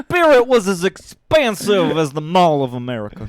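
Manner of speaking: hammed-up southern funeral speech; southern eulogy voice. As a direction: praise him grandly in the southern accent. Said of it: "do the eulogy in, in that voice".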